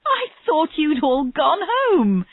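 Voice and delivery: high, slow, overjoyed exclamation; laughed exclamation. A narrator's reading. Tennyson to her cheering team, delighted and near laughter. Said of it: "Tennyson laughed".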